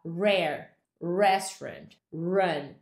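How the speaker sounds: rare, restaurant, run with an English consonant R at the start; English words opening on an R. Shown as a demonstration. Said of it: "R está na frente de uma vogal".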